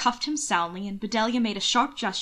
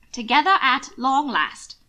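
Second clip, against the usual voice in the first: high, loud grumbling complaint; complaining; 5 semitones higher, 6 dB louder than usual. Acted. Aunt Grace complains to Hannibal, higher and louder. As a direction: whine it out, aggrieved.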